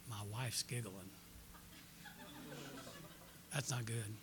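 low, hushed aside; dismayed low murmur; worried aside. Retold or imitated. Quiet aside while preaching, retold.

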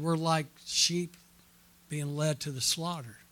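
usual speaking voice

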